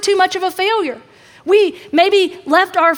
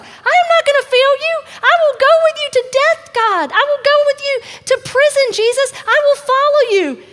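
much higher, louder, eager vow; boastful Peter; 10 semitones higher, 4 dB louder than usual. Acted. Peter promising Jesus loyalty, loud and high-pitched. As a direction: swear it with cocky zeal. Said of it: "Peter is where I was".

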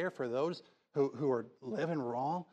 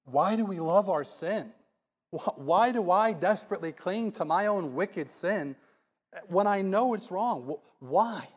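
rising, louder preacher's plea; impassioned preaching; 4 semitones higher, 4 dB louder than usual. Acted. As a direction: cry out against sin from the pulpit. Preacher's impassioned climax about sin.

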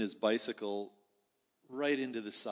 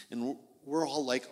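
ordinary delivery

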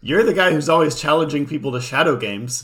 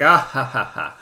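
maniacal laugh; 4 dB louder than usual. Retold or imitated. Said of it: "maniacally laughing".

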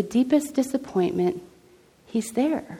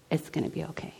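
very soft, lower comforting voice; quiet reassurance; 3 semitones lower, 13 dB quieter, faster than usual. Retold or imitated. Jesus calming her when anxiety revved up, quiet reassurance. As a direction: settle it gently, hushed.

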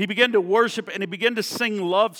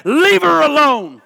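commanding rebuke; 7 semitones higher, 9 dB louder than usual. Acted. Jesus said.

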